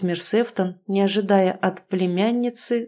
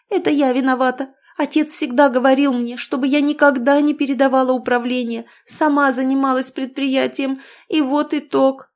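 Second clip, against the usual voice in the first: mock tearful; 6 semitones higher, 4 dB louder than usual. A narrator's reading. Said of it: "Всхлипнула".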